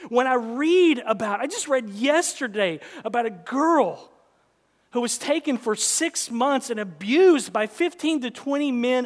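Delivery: loud, high, building sermon; rising pulpit passion; full-force preaching climb. Acted.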